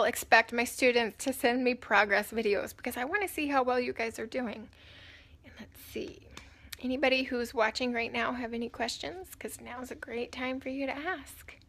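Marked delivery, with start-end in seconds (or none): 5.4-6.3 whisper. Real, not acted. Muttering to herself.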